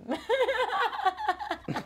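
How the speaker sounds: high-pitched giggle; laugh. Real, not acted. Storyteller laughing at her ghost call, giggling.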